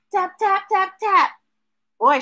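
plain reading voice

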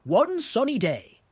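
ordinary delivery

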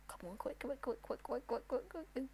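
very soft, rapid-fire muttering; hushed urging. Real, not acted. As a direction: urge it on under your breath. Urging the game on under breath.

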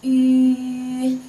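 "Yu" held long, high and level; level vowel demonstration. Shown as a demonstration. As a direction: sustain "Yu" loudly on one pitch. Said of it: "we call it".

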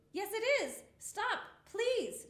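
plain reading voice

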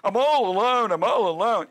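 mock self-pitying moan; sing-song the self-pity, mocking him; whining Elijah. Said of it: "This is his theme".

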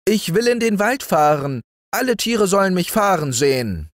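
put-on high child voice, rushing ahead fast; eager little boy. Häwelmann telling the moon his plan, high and fast. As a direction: blurt out the plan like an excited small boy. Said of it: "sagte Häwelmann".

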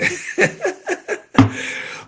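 high laughter after the joke; high, amused cackling laugh; punchline laugh. Real, not acted. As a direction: laugh at your own punchline.

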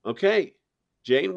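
ordinary delivery

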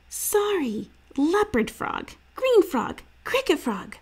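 put-on high, eager kid's guesses; young boy guessing. A narrator's reading. Little brother guessing frog calls, high and eager. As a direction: rattle off the names like a hopeful little brother.

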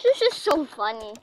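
ordinary delivery

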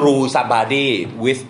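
each word drawn out for the class to copy; slow dictation. Shown as a demonstration. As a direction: spell out the phrase slowly, word by word. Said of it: "ion fist หรือ ion hand เนี่ยมันใช้ได้ทั้งคู่". (ช้ามากเหมือนบอกให้จด)

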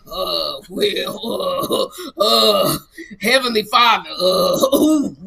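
groaning, halting start to a prayer; mock prayer warm-up. Friend struggling to begin praying, imitated groaning. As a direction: mimic someone moaning between the first holy words. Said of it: "and Kim's like".

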